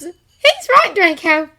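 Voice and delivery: high, loud female voice; young woman voice. A narrator's reading. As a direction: voice her firmly. Hermione voiced.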